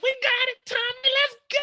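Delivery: high, squeaky thrill; excited girl. Retold or imitated. Driver cheering Tommy on in a high squeal. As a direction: squeal it like she's loving it. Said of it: "Mireya talking about".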